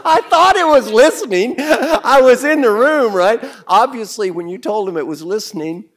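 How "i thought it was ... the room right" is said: high, loud laughing protest. Acted. Teacher protesting to the class, high and laughing. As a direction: plead your case while laughing.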